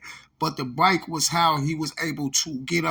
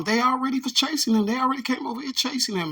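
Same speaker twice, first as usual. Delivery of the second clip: much higher, fast, a hammed-up woman's complaint; mock woman's voice; 10 semitones higher, faster than usual. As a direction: squeak it out quickly like the lady on the clip. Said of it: "She was like".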